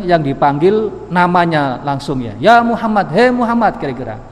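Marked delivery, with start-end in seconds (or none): 2.4-3.8 high, raised call across a distance; mock Bedouin calling out; imitated shouting. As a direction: hail him brashly from several meters away. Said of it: "teriak-teriak".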